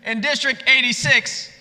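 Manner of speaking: high, projected hype to the crowd; rally speech. Real, not acted. Speaker setting up a call and response, high rally voice.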